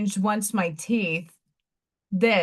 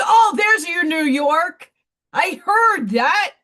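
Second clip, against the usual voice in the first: high, loud, drawn-out gotcha; slow, crowing mock accusation; teasing therapist; 7 semitones higher, 6 dB louder, slower than usual. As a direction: imitate her pouncing on the accent slip. Acts out therapist teasing about accent.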